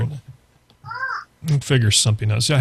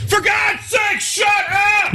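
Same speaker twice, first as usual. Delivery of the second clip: much higher shrieking yell; girly shout; much slower than usual. Acted. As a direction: shout it in a high girl voice. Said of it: "See what these voices are doing to you".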